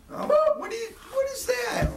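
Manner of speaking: high shocked cry; disgusted shriek. Acted.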